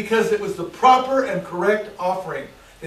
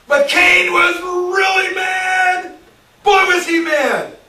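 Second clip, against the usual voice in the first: higher, louder, slow shout; 10 semitones higher, 8 dB louder, slower than usual. Acted. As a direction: roar out how furious Cain was. Preacher shouts Cain's anger.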